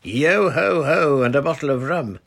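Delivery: loud, high sea-song shout; boy enjoying himself, shouting the pirate refrain; shouted shanty line. A narrator's reading. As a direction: roar it out like a pirate. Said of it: "shouted Roger".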